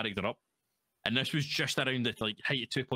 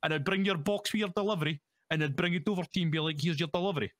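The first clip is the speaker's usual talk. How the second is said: much higher put-on Scottish character voice, rattled off; Hector Brocklebank voice; 8 semitones higher than usual. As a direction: chat like a cheery delivery man, pitched way up. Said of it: "And I'm talking like this".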